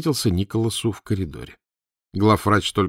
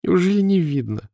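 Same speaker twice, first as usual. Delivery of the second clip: laughing through tears; 10 semitones higher than usual. A narrator's reading. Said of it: "засмеялась сквозь слёзы".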